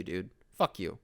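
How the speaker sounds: ordinary delivery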